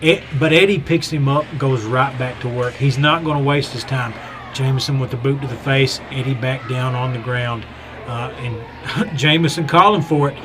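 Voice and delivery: calmer ringside play-by-play; wrestling commentator. Acted. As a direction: keep calling the match. Live match play-by-play.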